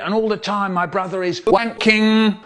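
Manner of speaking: ordinary delivery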